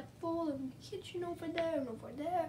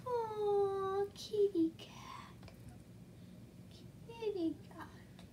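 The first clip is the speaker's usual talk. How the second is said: slow soft whisper to a cat; coaxing a cat with a slow whisper; 5 dB quieter, much slower than usual. Real, not acted. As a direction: breathe it to the kitty. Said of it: "whispering to the cat".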